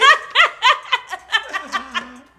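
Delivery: high squealing laugh. Real, not acted. Laughs at comment.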